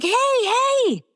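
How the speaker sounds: high, breezy mock greeting; imitating Timothy Oliphant. Actor greeting the front desk girl, high mock excitement. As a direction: chirp it like a friendly regular at the gym. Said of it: "he's like".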